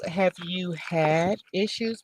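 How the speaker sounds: usual speaking voice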